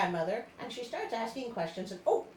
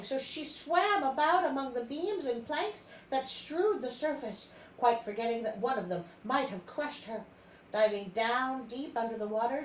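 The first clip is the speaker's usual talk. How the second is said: dramatic story reading; 3 semitones higher than usual. A narrator's reading. Reads story narration aloud.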